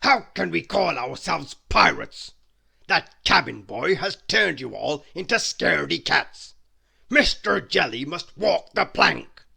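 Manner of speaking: loud, furious pirate captain; angry captain. Acted. Pirate captain furious at his cowardly crew. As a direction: bellow orders at the crew.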